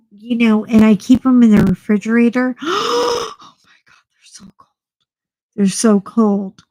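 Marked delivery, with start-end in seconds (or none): 2.6-3.3 high shocked gasp; sharp gasp. Acted. Vlogger acting out putting on a refrigerated mask, sharp cold gasp. 3.4-4.7 very soft whispered shock; breathless whisper. Acted. Vlogger reacting to a refrigerated facial mask, breathless whisper.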